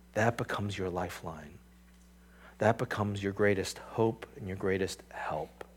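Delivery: hushed whispered close. Real, not acted. Preacher whispering the closing promise to his congregation, soft whisper. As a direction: whisper it like a secret hope.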